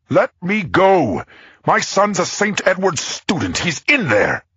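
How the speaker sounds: high, loud and frantic, stretched out slowly; a frightened father yelling at the cop to be let through; desperate yell. A narrator's reading. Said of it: "he yelled".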